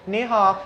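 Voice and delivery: "Ni hao" said high with Chinese tones; mock "Ni hao" as if taking the piss; Chinese greeting. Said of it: "I'm just going".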